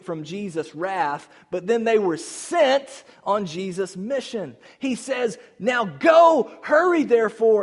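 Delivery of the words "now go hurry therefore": loud, urgent sending-out; rally them to go now; commanding shout. Acted. Jesus sending disciples out on mission: loud urgent command.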